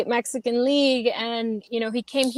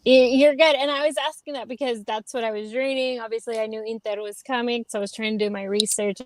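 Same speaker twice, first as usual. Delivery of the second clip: ordinary talk, nothing marked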